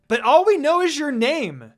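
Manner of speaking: high, loud group protest; indignant yell. A narrator's reading. Students loudly protesting the sensei's empty introduction. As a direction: yell it together, outraged. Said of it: "Sakura yelled".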